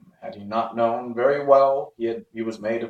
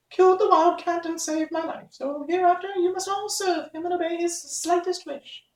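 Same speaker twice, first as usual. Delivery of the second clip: much higher tiny squeak, giving orders; mouse queen commanding. Acted. Mouse queen orders her mice to serve the Woodman, high squeak.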